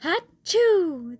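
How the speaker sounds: big high achoo; loud sneeze. Acted. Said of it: "Then I sneezed".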